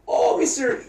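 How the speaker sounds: high put-on orderly voice; imitated orderly. Orderly caught scolding someone as Happy visits, imitated high voice. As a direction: lift the pitch for a mock orderly. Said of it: "he's, like, scolding someone".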